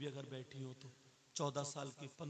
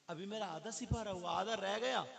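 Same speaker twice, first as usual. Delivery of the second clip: put-on girl's protest; 6 semitones higher, 8 dB louder than usual. Girl protesting to friend, voiced.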